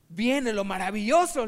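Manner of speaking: loud, grand announcement; excited promise. Acted. Student selling riches to the fisherman, loud and grand. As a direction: announce the wonderful part. Student describes getting rich.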